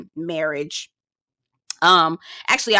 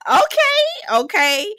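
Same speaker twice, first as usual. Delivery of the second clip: amused agreement; 10 semitones higher, slower than usual. Real, not acted. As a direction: squeal it, still tickled. Reacting after laughing at joke.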